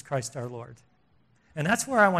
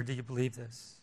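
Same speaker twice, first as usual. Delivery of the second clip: very soft, lower, searching question; Jesus; 4 semitones lower, 10 dB quieter than usual. Acted. Jesus asking Martha about her faith, quietly passionate. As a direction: ask it almost under the breath, earnestly. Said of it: "speaking like this with such passion".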